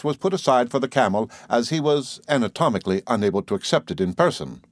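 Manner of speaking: plain reading voice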